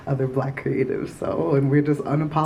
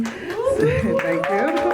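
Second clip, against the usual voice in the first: laughing. Real, not acted. Laughing thanks to applause.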